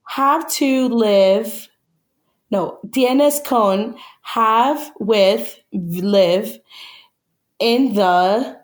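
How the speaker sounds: slow, halting delivery with drawn-out words; word-by-word translating. Real, not acted. Guest translates a Spanish film quote for co-host, slow and halting. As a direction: feel your way through each word slowly.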